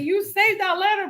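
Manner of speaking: high-pitched retold accusation; exploding. Wife accuses husband of saving a letter, retold exploding. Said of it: "I kinda just exploded".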